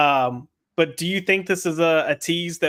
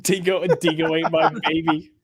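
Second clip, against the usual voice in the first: mock Australian accent. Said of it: "some Australian accents".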